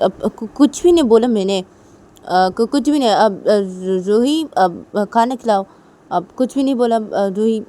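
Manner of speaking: loud, stammering backpedal; flustered denial. Acted. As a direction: stumble over a hasty denial. घबराया पिता सफ़ाई देता है. (घबराकर हकलाते हुए सफ़ाई)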